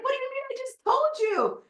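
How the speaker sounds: exasperated. Retold or imitated. Frustrated partner quote.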